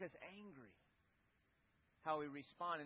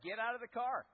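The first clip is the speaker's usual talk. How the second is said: firm, somewhat louder retold command; curt angry order; 5 semitones higher, 7 dB louder than usual. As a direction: cut him off short. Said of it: "He's just like".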